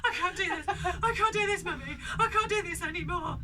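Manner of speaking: high, loud mock panicked wail; panicking child. Mum imitates daughter panicking in bed, mock wail. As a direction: ham up a breathless little girl. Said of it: "she's going".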